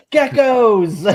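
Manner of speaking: high, loud mock American-accent outburst; Jude Law. Host mocks an actor's attempted American accent, high exaggerated cry. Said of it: "Jude Law trying to do an American accent".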